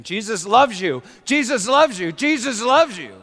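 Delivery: repeated loud cries, LOVES stressed; retold screaming. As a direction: cry it out again and again. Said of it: "He started screaming".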